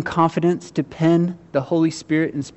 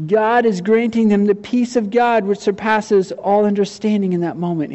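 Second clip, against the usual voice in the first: normal talking voice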